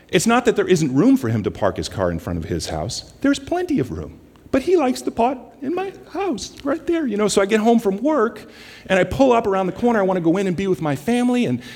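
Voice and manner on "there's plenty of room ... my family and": much higher, rushing, worked-up complaining; a petty grievance building up. Retold or imitated. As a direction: rattle it off high and fast, getting riled. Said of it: "I get all worked up".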